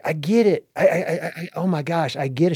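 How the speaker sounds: excited. Retold or imitated. Retold breakthrough moment.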